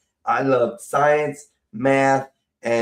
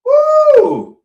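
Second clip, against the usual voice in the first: whoop; 10 dB louder than usual. Real, not acted. Reacting to harsh warm beer.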